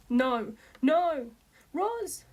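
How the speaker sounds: a father yelling after his daughter being taken; desperate shout. A narrator's reading. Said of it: "he shouted".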